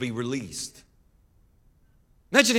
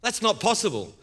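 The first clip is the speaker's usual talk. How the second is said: mock disbelief; 7 semitones higher than usual. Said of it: "completely like".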